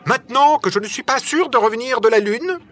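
high, insistent and curt. A narrator's reading. Nicole demands answers from his companions, curt tone. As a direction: press the point sharply. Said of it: "d'un ton sec".

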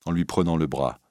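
ordinary delivery

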